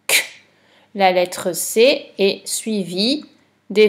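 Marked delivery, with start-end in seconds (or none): 0.1-0.4 Q as a bare "k"; just the hard consonant "k" for Q; isolated sound. Shown as a demonstration. Teacher explaining how to make the sound, Q as bare "k". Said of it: "pour faire le son".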